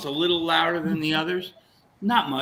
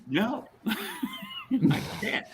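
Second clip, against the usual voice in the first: laughing; 4 dB quieter, much slower than usual. Retold or imitated. Refusing while laughing.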